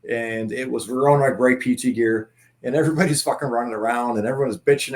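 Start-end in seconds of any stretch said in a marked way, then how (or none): none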